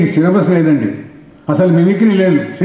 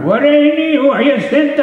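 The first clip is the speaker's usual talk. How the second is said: put-on very high, slow, cracked old man's voice; old man asking; 11 semitones higher, much slower than usual. Old man asks his friend's age, high and slow. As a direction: stretch it out like a frail old man. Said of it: "ఇద్దరు ముసలాళ్ళు మాట్లాడుకుంటున్నారు".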